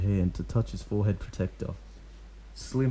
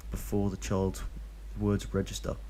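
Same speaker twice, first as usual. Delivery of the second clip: ordinary delivery, nothing marked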